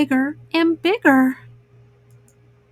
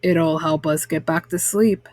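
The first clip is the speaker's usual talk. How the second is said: lower, gentle fatherly voice; Papa Bear soothing the family back to bed, lower; 9 semitones lower than usual. A narrator's reading. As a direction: speak like a calm papa bear offering milk. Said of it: "said Papa".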